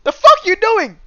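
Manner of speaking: screaming high and loud at her; loud high-pitched rage at the woman filming; pretend furious yell. Pretend yelling at the woman who recorded. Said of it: "I would've been like".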